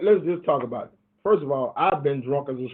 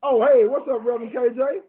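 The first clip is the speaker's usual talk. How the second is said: excited greeting; 8 semitones higher, faster than usual. Real, not acted. Host greets surprise caller.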